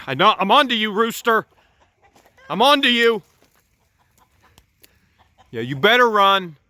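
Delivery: loud, high taunt at the rooster; calling out a mock threat. Taunting a runaway rooster from afar, loud mock threat.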